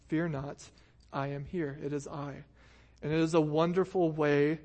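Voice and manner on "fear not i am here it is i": softer, tender reassurance; soft reassuring voice; gentle comfort. Shown as a demonstration. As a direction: hush a scared child's fears. Jesus comforting, read softly.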